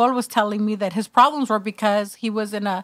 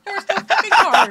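laughing; 6 semitones higher than usual. Real, not acted. Laughing at the reading story.

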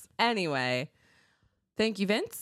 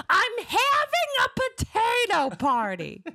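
high, slow squawk through laughter; Gilbert Gottfried voice; 5 dB louder, slower than usual. Acted. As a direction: echo it high and slow like the comic. Repeats line in Gottfried voice.

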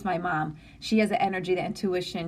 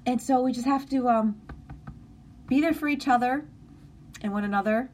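getting emotional; 5 semitones higher than usual. Real, not acted. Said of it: "I'm getting emotional now".

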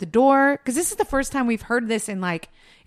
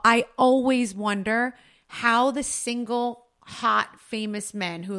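ordinary talk

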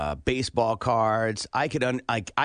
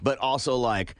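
ordinary talk, nothing marked

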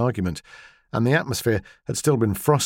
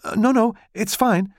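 hurried; 12 semitones higher than usual. Acted. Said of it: "said Macy hurriedly".

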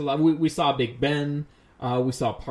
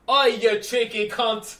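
slow, higher cockney yell; British pub yell; 8 semitones higher, 3 dB louder, much slower than usual. Retold or imitated. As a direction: yell like a London bloke. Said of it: "people just yelled at each other".

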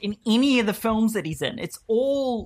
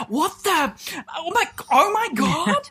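high-pitched, drawn-out re-enacted astonishment; gobsmacked, voice leaping up; stunned disbelief; 12 semitones higher than usual. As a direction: act out a cinema audience losing it. Audience losing it at the accent.

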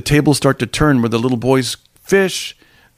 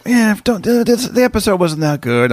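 higher-pitched, lightly voiced dismissive wife; imitating wife; 7 semitones higher than usual. Wife waving off a TV episode, mildly dismissive. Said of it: "even my wife was like".

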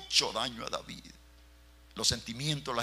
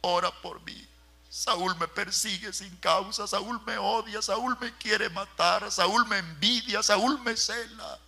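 high, crying plea, words stretched slow; a young man weeping as he begs for prayer; tearful plea; 9 semitones higher, 4 dB louder, slower than usual. A narrator's reading. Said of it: "Pero le dijo llorando". (súplica llorosa y aguda)

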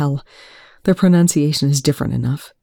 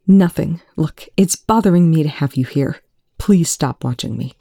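ordinary delivery, nothing marked

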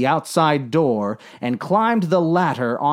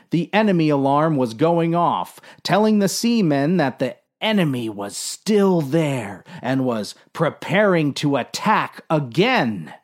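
ordinary delivery

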